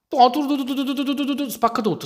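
high rapid tu-tu-tu drilling; playful vocal drill sound effect; drill noise. Pretend. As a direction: mimic a drill hammering away.

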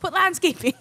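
high, loud eager suggestion; excited. Retold or imitated. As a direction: sell the idea with a laugh. Coaching kid on resume.

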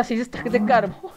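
words dissolving into a nervous laugh; nervous laughter. Real, not acted. Streamer dreading six stacks of coal, nervously laughing. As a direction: start incredulous, crack up nervously at the end. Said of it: "es como una risa pero nerviosa".

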